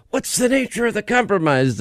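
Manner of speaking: high mocking congressman voice; Adam Schiff impression. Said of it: "That's Adam Schiff".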